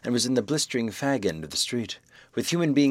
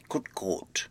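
hushed dismay; 3 semitones lower, 6 dB quieter than usual. Acted. Doctor realizes man is dead.

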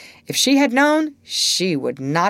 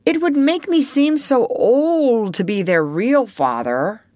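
high, louder pleading excuse; apologetic; 7 semitones higher, 5 dB louder than usual. A narrator's reading. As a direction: plead your case in a raised, boyish pitch. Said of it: "continued apologetically".

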